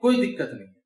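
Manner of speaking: low, casual, self-assured; confident speaker. Pretend. Lightly voiced confident learner reassuring a listener. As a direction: brush it off easily. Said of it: "ऐसा नहीं लगेगा कि".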